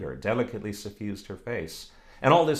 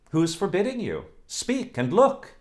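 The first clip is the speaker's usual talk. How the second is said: teasing coquette; 10 semitones higher, 5 dB louder than usual. Acted. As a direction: toss it off coolly. Oksana teasing.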